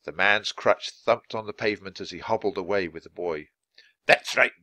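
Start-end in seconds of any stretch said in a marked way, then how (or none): 4.1-4.5 louder, put-on innkeeper's yell; gruff send-off called after them. A narrator's reading. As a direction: shout them off the premises. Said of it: "Mister Pinch yelled after them".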